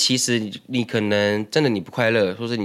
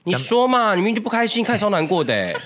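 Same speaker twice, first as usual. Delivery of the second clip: pushy friend; 9 semitones higher than usual. Acted.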